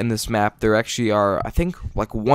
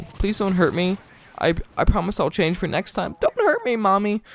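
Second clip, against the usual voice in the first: put-on higher, whiny pleading like a scared little kid; mock scared child; 8 semitones higher than usual. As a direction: squeak out a hammed-up plea to mommy, jumping very high on the last words. Jokey plea to Italian viewers.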